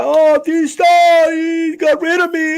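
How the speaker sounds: high, loud mocking whine; mock crybaby whine. Streamer mocks a banned troll crying to him, mock whiny shout. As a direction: whine it like a sore loser, hammed up. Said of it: "and if you wanna cry".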